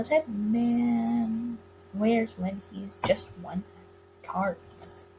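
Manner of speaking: slow and careful; deliberately slow retake. Acted. Retake of the misread line.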